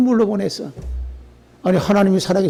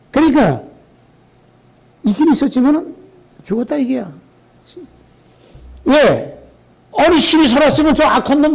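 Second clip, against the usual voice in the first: higher, louder impassioned sermon; fiery preaching; 7 semitones higher, 3 dB louder than usual. Acted. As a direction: drive the point home to the congregation. Preaching at full force.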